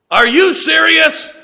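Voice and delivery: high, drawn-out loud mimicry; mock-incredulous shout. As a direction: throw her words back, hugely exaggerated. Father throws her words back at her.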